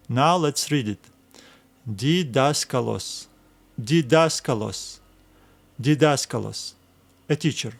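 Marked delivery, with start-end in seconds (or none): 1.9-6.7 "Didaskalos" stressed on DAS, then falling; stress on the alpha. Shown as a demonstration. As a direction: read "Didaskalos" as di-DAS-ka-los, leaning on the second syllable. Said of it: "We'll need to stress this alpha".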